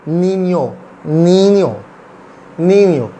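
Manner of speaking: "नीनियो" with n gliding into y; N with dash as ny. Shown as a demonstration. Teacher showing the dashed N, ny sound. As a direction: model "नीनियो" with a falling ny-o ending. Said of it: "इसको हम कैसे pronounce करते हैं".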